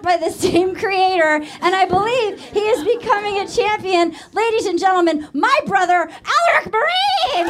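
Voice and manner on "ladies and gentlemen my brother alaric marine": announcer introduction. Real, not acted. Host presenting their brother to the crowd, announcer shout. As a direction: build to a high, shouted name reveal.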